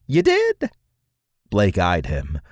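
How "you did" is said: squeaky little squirrel creature, very high; high-pitched voice. Acted. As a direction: introduce yourself in a piping, high voice with soaring word endings. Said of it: "in a high-pitched voice".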